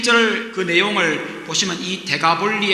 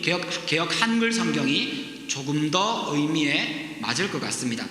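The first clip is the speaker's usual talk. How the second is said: normal talking voice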